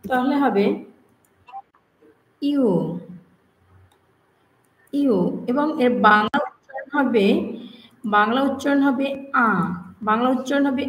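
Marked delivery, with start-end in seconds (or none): 2.4-5.2 "ইউ" gliding like yoo; native-style "ইউ". Shown as a demonstration. Teacher models U the native way, "ইউ". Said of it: "native language তাদের মতে".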